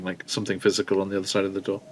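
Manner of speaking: ordinary talk, nothing marked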